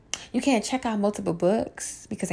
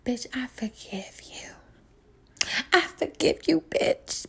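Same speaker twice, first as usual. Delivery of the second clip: soft whispered forgiveness, slow; forgiving girlfriend; 3 semitones higher, 5 dB quieter, slower than usual. Retold or imitated. Girlfriend forgiving the singer, hushed and moved. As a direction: breathe it out, moved by the singing. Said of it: "girlfriends probably look and be like".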